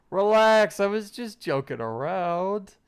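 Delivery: loud, laughing reassurance; amused teacher. Acted.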